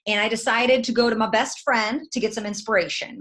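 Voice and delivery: usual speaking voice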